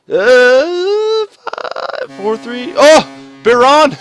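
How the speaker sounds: high, loud thrilled cheering; counting down to the win; excited countdown. Real, not acted. Gamer cheering the last seconds of game seven, excited.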